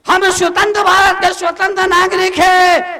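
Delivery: high, forceful sermon shout; preaching at full force. Real, not acted. Monk urging families to change themselves, full-force preaching.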